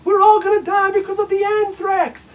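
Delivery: high, loud panicky shout; hammed-up doom shout; mock panic. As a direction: wail it like the sky is falling. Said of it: "Then it was".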